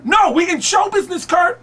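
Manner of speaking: mock-outraged high shout; hammed-up yelling, far above normal pitch; Tracy Morgan. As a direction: bellow the correction as an impersonation. Tracy yelling at Kurt, acted.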